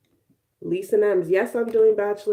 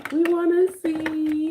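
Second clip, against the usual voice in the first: higher, slow, teasing voice for the viewers; mock viewers' voice; 9 semitones higher, 4 dB louder, slower than usual. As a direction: drag it out like an eager fan. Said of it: "some of y'all was like".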